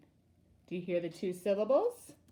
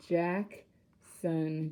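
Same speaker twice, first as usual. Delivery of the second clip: "Jackson" in two slow parts, a gap between them; split into syllables; 3 semitones lower than usual. Shown as a demonstration. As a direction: say each syllable of "Jackson" on its own. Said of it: "We know that's two syllables".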